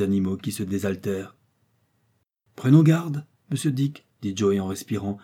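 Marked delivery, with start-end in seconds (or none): 2.6-4.1 cautioning. A narrator's reading. Joey cautions his companion.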